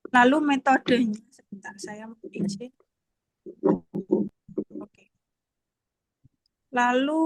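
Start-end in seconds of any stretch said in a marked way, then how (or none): 1.3-5.1 quick whisper off-mic; whispered aside. Real, not acted. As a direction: whisper it so the room can't hear. Aside while presenting online. (berbisik pelan)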